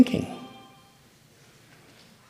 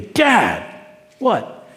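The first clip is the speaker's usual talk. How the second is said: higher, eager shout of news; excited sons; 8 semitones higher than usual. Acted. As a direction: blurt it out to Dad, breathless with gossip. Sons bursting in on their father.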